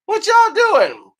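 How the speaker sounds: slow, high falsetto girl imitation; mimicking girls. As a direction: stretch it out in a put-on girly squeak. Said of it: "Girls be like".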